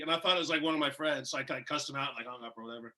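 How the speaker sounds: normal talking voice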